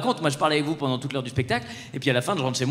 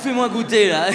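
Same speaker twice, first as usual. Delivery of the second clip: put-on cocky kid; 6 semitones higher, 3 dB louder than usual. Son challenging the nurse.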